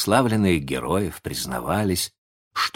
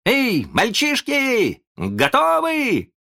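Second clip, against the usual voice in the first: high, slow, merry hail; cheerful call; much slower than usual. A narrator's reading. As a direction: call the boys with glee. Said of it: "весёлый голос Питера". (весёлый высокий призыв к мальчишкам)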